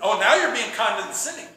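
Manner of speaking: acted indignant retort; mock offended. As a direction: fire back as if insulted. Acted argument over being yelled at.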